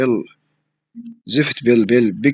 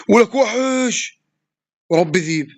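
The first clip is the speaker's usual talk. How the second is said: hyped praise; 6 semitones higher, much slower than usual. Real, not acted. Celebrating a good play.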